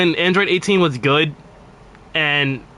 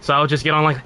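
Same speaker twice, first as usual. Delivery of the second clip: usual speaking voice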